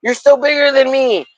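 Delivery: high, teasing sing-song; hammed-up pleading. Streamer teasing Peter about his channel, high and playful. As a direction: coax him like a nursery rhyme. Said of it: "I'm like".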